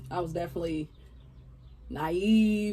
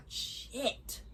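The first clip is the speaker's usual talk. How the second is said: whispered curse; 4 semitones higher, 10 dB quieter than usual. Retold or imitated. Shocked aside under breath.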